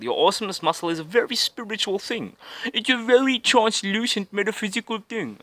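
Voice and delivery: high-pitched put-on spiritual voice; mock-mystical guru. Mock-mystical put-on voice about awesomeness.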